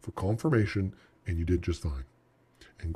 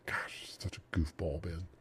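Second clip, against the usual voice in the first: whispered, softer, teasing himself; self-mocking whisper; 3 semitones lower, 10 dB quieter than usual. Trader whispering a joke at his own mistake. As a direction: breathe it like a private eye-roll at yourself. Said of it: "I'm like".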